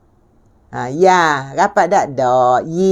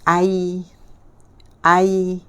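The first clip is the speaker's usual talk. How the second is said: normal talking voice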